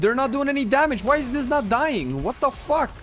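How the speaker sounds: high, loud disbelieving yelps; panicking at the screen, pitch way up; frantic. Real, not acted. Mobs won't die, frustrated.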